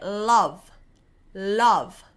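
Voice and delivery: love with a big tongue swish; English L. Shown as a demonstration. Teacher showing the tongue motion in love. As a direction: say love with the tongue making a wave. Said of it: "can you feel how your tongue".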